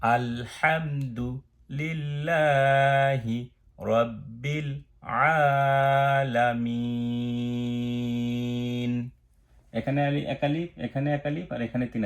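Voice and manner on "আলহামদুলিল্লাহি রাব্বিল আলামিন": slow chanted আলামিন with the মি held long; slow recitation. Shown as a demonstration. Teacher recites the verse slowly to model lengthening. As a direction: intone it, stretching the last syllable. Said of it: "তাহলে".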